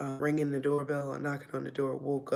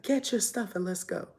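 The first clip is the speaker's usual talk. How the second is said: higher, sharper command from father; stern order; 6 semitones higher, 3 dB louder than usual. Retold or imitated. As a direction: order her out, firmly. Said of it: "and he's like".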